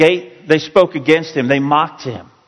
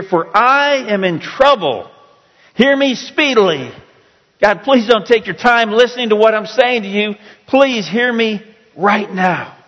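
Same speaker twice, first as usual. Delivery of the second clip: high, stretched-out plea to God; urgent pleading; 8 semitones higher than usual. Acted. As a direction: beg to be heard at once, voice pitched well up. Acts out psalmist's urgent plea.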